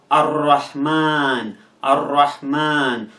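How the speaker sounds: "Ar-Rahman" slowly with a long drawn-out final aa; teacher's slow "Ar-Rahman", second a held long; prolonged A. Shown as a demonstration. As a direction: stretch the last vowel, Ar-Rahmaan, in "Ar-Rahman". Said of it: "that part of the text should be prolonged".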